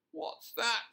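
high, muffled fox voice from inside a tree. A narrator's reading. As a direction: sound stuck and muffled. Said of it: "said a muffled voice".

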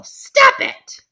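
high, loud command; angry shout. Pretend. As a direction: yell it to make him stop. Bonnie scolds bully.

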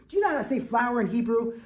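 usual speaking voice

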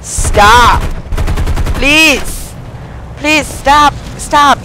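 high, loud begging; desperate pleading. Real, not acted. Gamer begging shooter to stop, desperate pleading.

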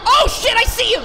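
high, loud panicked yell; terrified shout. Real, not acted. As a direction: scream it as he appears. Sees monster, shouts.

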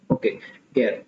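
ordinary talk

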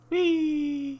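very high, drawn-out whee; playful whee. Real, not acted. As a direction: squeal a long, piping whee. Playful cry while flying in game.

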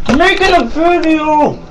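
high squeaky put-on voice; silly voice. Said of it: "ignore that voice".

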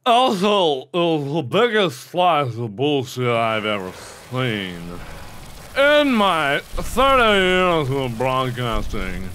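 put-on low, slow old broadcaster voice; low mock-solemn announcer voice; mock veteran broadcaster. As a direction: deliver a grave commentator's verdict, hammed up.

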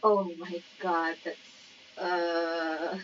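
usual speaking voice

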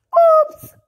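high startled oops; embarrassed oops. Acted. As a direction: squeak it out, caught off guard. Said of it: "dijo Horacio".

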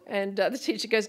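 ordinary delivery

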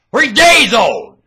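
high, slow, very loud yell; slow bellow on a high pitch; drawn-out shout. Real, not acted. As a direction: stretch it out at full voice. Repeats it as a shout.

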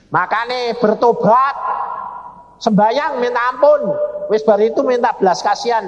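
high, insistent urging; scolding exhortation. Retold or imitated. Husband telling his weeping wife to repent, scolding.

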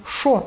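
що said as "sho"; plain sh at the start of що; dialect що. Shown as a demonstration. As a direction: Podillia що, no ch after sh. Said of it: "в нас кажуть".

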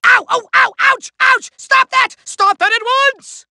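high, yelping cries under repeated blows; cartoon villain squealing in indignant pain; pained protest. Villain being hit on head.

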